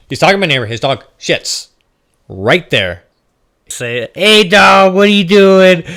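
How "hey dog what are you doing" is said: loud, high call across the yard; yelling at the roommate. Retold or imitated. Retelling yelling at roommate over dog poop, loud call. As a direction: shout it at the guy ignoring his dog.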